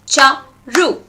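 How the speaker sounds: CHA, RU as two separate beats; broken into syllables. Shown as a demonstration. Teacher claps out her name for students, cha - ru split apart. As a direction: say cha, ru with a gap between syllables. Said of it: "clap and find the syllables".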